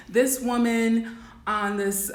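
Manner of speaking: normal talking voice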